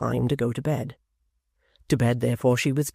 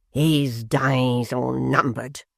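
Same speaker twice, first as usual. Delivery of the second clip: very slow, grave declaring; ominous pompous vow; much slower than usual. A narrator's reading. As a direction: pronounce a doom solemnly. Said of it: "said Mister Tappertit".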